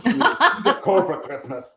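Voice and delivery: a lightly voiced angry Santa scolding; mildly furious Santa. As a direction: scold him like a cross Santa. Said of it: "he goes".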